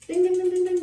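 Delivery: high, loud run of dings; imitated bell. Parent hearing the child finally get it, high bell imitation. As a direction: chime like a right-answer bell.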